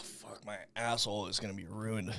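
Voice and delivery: hushed whisper; whispered aside. Real, not acted. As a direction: lean in and whisper it. Aside to the viewers.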